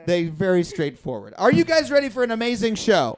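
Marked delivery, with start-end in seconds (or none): none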